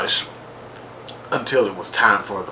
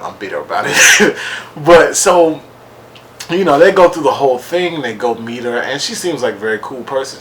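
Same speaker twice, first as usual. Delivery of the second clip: ordinary talk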